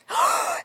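sharp, high mock gasp; horrified gasp. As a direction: suck in air, imitating shocked children. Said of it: "gasped in horror".

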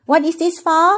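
Thai-accented English, pitched high; สำเนียงไทย. Shown as a demonstration. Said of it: "ไงถามคุณป้าว่านี่คืออะไรวะ?". (ภาษาอังกฤษสำเนียงไทย เสียงสูง)

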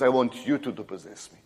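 ordinary talk, nothing marked

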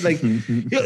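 ordinary delivery

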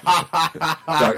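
imitating a booming laugh; mock laugh. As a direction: fake a hearty laugh.

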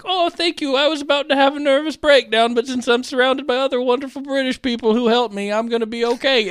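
very high, gushing mock thanks; grateful contestant. Susan thanking fellow baker, mock gushing. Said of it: "And she's like".

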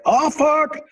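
sudden high yelp of a curse; startled curse. Retold or imitated. Neighbour stepping onto the balcony, shocked cry. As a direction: blurt it out, caught off guard. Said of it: "He was like".

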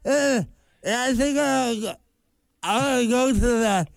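talking with a propped-open mouth; slow mumble through dental tools; mouth held open. Retold or imitated. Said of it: "before you ask me a question".

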